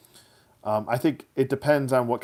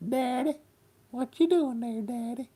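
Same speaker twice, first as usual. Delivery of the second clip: high-pitched Southern talking-dog voice; squeaky, high Southern doggy voice; Dusty dog voice. Pretend. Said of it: "That's my Dusty voice for my dog".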